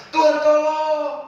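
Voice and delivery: high, loud plea; praying with a loud call for help; loud cry for help. Acted. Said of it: "pertama seru keras".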